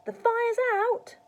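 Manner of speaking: put-on high, loud, slow boyish announcement; proud announcement. A narrator's reading. George having doused Grandma, proud announcement. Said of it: "George announced proudly".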